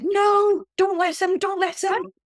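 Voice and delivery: urgent plea to stop him; chorus of protest. Retold or imitated. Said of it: "five voices said".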